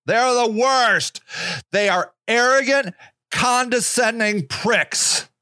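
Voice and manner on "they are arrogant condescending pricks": ordinary talk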